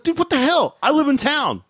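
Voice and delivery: high, incredulous outburst at cop; outraged protest. Retold or imitated. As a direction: blurt out in disbelief. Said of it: "I'm like".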